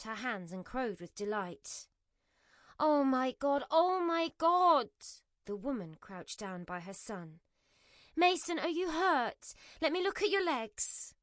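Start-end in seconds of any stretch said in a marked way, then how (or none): 2.8-4.9 loud, slow, panicked cry. Acted. Sauce bottle smashes, woman panics. 8.2-11.1 worried mother. Acted. Mother checks on crying son.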